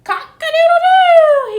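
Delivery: loud high screaming crow; full-throated rooster call. A narrator's reading. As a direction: crow over the wind like a rooster. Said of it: "he screamed".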